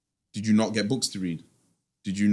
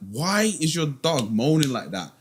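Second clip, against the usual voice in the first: high, exasperated mock neighbour; fed-up neighbour; 11 semitones higher than usual. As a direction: squeak out the complaint like a neighbour at the end of his rope. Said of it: "and he would be like".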